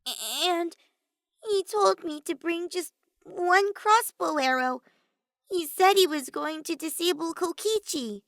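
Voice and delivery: timid under Maki's glare; Himiko's voice. A narrator's reading. Himiko shrinks from Maki's dark look, high and timid.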